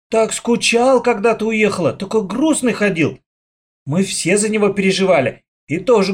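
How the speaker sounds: put-on high, bubbly chatter of an excited girl; squealing with joy. A narrator's reading. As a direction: gush with happiness. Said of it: "завизжала от радости".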